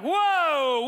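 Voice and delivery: mock astonished "whoa"; startled whoa. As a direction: act out being taken aback. Acted startled reaction to TV screen.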